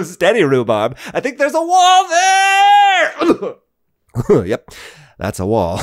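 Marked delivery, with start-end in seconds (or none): 1.1-3.6 high, very slow panicked wail; alarmed cry. Pretend. Host on the rhino, crying out at a wall. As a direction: cry out before the crash.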